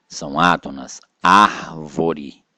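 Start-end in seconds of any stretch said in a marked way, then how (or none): 1.2-2.4 "Árvore" with ÁR punched loud, the rest weaker; stress on ÁR. Shown as a demonstration. Teacher showing which syllable is tonic, stress on ÁR in "Árvore". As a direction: hit the first syllable of "Árvore". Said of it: "Ar é a tônica".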